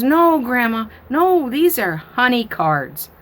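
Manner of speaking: put-on insistent little girl. Granddaughter correcting grandma, high, slow and insistent. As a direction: correct grandma patiently like a small child.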